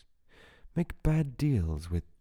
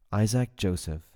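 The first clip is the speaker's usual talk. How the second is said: ordinary delivery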